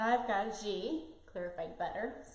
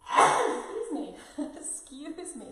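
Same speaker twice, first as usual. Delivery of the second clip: sneezing; 5 semitones higher, 7 dB louder, slower than usual. Real, not acted. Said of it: "excuse my, uh, my sneeze".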